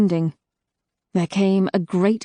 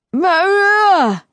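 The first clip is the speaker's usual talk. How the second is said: a sudden panicked high cry; frightened cry; 11 semitones higher, 7 dB louder than usual. A narrator's reading. Terrified Tor crying out as chains clang. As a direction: cry out a name in fear.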